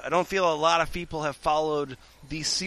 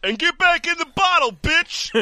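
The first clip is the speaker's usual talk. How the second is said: mock bossy yell; 14 semitones higher, 5 dB louder than usual. Acting out bossing the genie.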